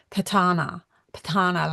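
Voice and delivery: Patana said puh-TAH-na, stress on the middle; demonstrated pronunciation. Shown as a demonstration. Host showing how the tribe's name is said, careful pronunciation. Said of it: "they say it like".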